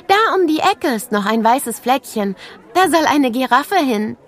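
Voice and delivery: hohe, helle Mädchenstimme, einladend; begrüß die Kleinen wie ein stolzes Schulkind; freundliches Schulmädchen. A narrator's reading. Mia zeigt die Ecke. (Mia begrüßt Nele am Schultor: hoch und freundlich)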